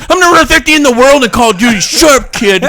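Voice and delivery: high, fast, loud gamer rant; mock angry yell. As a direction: yell down at the kid. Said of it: "I was literally yelling at him".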